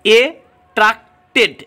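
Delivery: Attracted broken into a-trac-ted with pauses; syllable by syllable. Shown as a demonstration. Teacher showing the word to students, syllable by syllable. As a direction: sound out attracted one part at a time. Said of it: "আলাদা আলাদাভাবে syllable".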